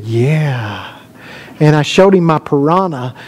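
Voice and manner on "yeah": low, soft imitation of a boy's yeah; awed little boy. Preacher quotes boy offered a look at a fish, awed. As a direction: imitate a fascinated child, hushed and low.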